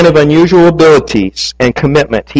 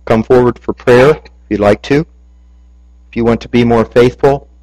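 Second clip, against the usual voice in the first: ordinary talk, nothing marked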